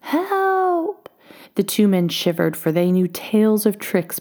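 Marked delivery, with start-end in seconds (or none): 0.0-1.1 high, drawn-out wailing cry; cry for help. A narrator's reading. Unseen little man cries through the darkness, high wail. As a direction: call out far higher, stretching the word. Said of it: "through the darkness came a cry".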